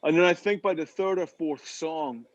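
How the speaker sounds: ordinary talk, nothing marked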